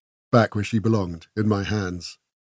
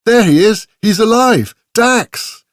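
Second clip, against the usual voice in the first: high, loud cry of joy at seeing him; joyful shout; 12 semitones higher, 7 dB louder, slower than usual. A narrator's reading. Esmeralda shouting to Dax, alive after all, joyful shout. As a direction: call out his name in delight.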